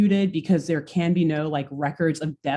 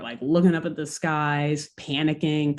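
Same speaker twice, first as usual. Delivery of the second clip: usual speaking voice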